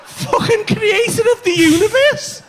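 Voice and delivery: loud-ish, high, slow disbelief; mock incredulity. Storyteller repeating his friend's possession claim, high drawn-out incredulity. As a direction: draw it out high, scornfully amazed.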